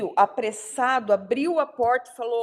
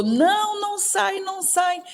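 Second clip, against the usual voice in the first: much higher mock yell of warning; retold shout; 10 semitones higher than usual. Son yelling at his parents not to go out, high mock shout. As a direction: cry out high to stop them leaving. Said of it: "ele gritou".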